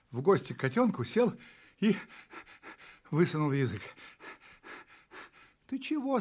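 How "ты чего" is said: high-pitched question at normal volume; curious kitten. A narrator's reading. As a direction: ask in a high little kitten voice. Kitten asks the panting puppy. (удивлённый вопрос котёнка)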